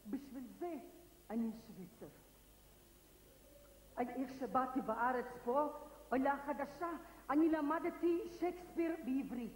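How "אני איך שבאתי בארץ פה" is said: usual speaking voice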